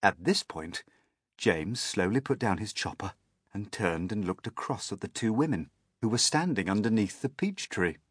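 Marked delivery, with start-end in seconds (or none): none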